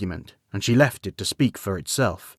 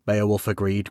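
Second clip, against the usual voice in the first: ordinary delivery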